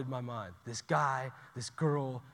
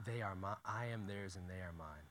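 normal talking voice

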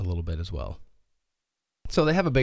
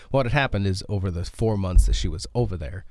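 ordinary talk

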